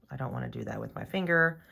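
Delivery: normal talking voice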